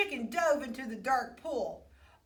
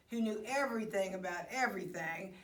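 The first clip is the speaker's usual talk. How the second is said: ordinary delivery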